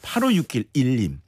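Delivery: normal talking voice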